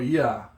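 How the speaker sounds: "ya" in one joined syllable; unseparated vowels. Shown as a demonstration. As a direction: slide straight through "ya" without a catch. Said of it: "If you don't separate it".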